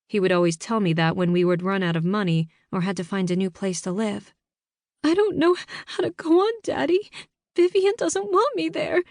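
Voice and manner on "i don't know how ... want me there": put-on high, tearful teenage girl; crying through hiccups on the phone; hiccuping sob. A narrator's reading. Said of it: "I hiccuped into the phone".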